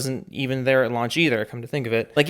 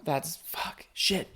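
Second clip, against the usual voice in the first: whispered swearing; 2 semitones higher, 8 dB quieter, much slower than usual. Real, not acted. Muttered cursing in disbelief.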